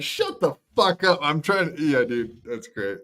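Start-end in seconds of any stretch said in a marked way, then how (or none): 0.0-1.8 annoyed. Retold or imitated. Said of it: "Look how annoyed he is".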